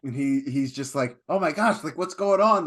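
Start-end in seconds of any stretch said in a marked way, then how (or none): 1.3-2.6 higher-pitched panicky retelling; playful alarmed voice; mock freak-out. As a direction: imitate someone starting to panic, pitched up. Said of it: "he's freaking out".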